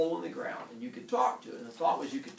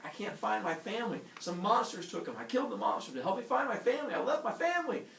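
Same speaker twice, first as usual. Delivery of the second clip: breathless-sounding rush climbing higher; frantic plea; 8 semitones higher than usual. Retold or imitated. Heracles begging villagers to find his family, mounting panic. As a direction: mock-desperate begging, pitch rising to the end.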